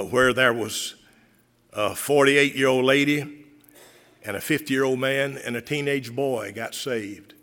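ordinary talk, nothing marked

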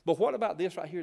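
ordinary talk

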